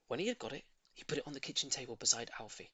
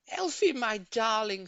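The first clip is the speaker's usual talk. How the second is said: high, loud doting owner's call; Mrs Silver crying out; 8 semitones higher, 7 dB louder than usual. A narrator's reading. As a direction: call out sweetly to her pet. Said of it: "she cried out".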